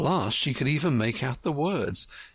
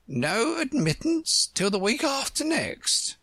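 much higher, squawky creature voice; a beaked doorkeeper snapping; high beaked doorman; long-beaked creature; 12 semitones higher than usual. Acted. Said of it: "creature with a long beak ... said".

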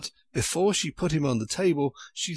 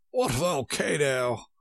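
anxious king; slower than usual. Acted. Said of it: "said the king, looking up anxiously".

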